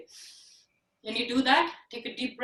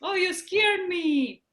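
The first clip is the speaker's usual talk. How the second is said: mock scared; 6 semitones higher than usual.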